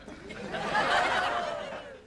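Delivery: high cackling giggle at his own joke; cackling laugh. Acted. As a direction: cackle with glee. Comic dirty laugh.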